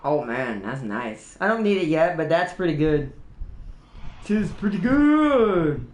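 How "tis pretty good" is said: slow, stretched last word; jokey elongated repeat; playfully drawn out. Pretend. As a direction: linger on "good" in a mock-approving way.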